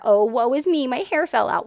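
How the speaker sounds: mocking her own moping in the mirror, hammed-up whine; mock self-pity. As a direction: parody a sulking, sorry-for-herself voice.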